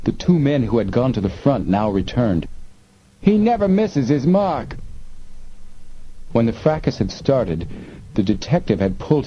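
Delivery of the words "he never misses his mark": high-pitched alarmed call; warning call. A narrator's reading. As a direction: warn the gang in a much higher voice. Smugglers shouting warnings about gun.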